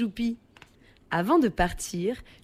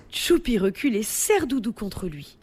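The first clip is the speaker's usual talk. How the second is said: ordinary delivery, nothing marked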